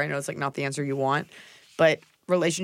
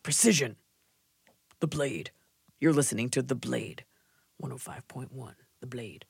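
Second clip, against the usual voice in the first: radio announcer; 4 semitones lower, 5 dB quieter than usual. Pretend. Radio station ident parody.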